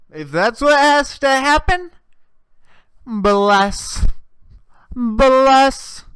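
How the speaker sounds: high, hammed-up holy-roller blessing; churchy blessing. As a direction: ham up a pious church auntie blessing.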